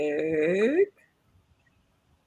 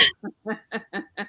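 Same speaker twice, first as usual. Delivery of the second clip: slow villainous cackle; evil laugh; 4 semitones lower, 12 dB quieter than usual. Acted. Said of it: "that was an evil laugh".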